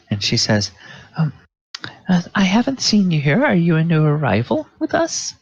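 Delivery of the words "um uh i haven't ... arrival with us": higher, courteous spa attendant voice; polite moon elf attendant. Acted. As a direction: greet the new guest sweetly. Moon elf greets newcomer.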